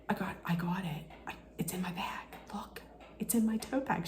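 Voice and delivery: urgent hushed whisper, barely any voice. Retold or imitated. Whispering to her friend Tara that she caught the ball. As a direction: breathe it so nobody nearby hears.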